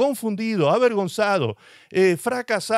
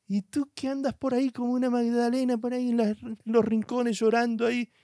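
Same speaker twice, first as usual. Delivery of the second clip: mocking scold; 7 semitones higher, 4 dB quieter than usual. Preacher scolds self-pity.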